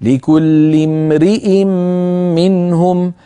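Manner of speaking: لكل امرئ drawn out slowly; slow tajwid reading; slow recitation. Shown as a demonstration. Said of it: "المثال الأخير".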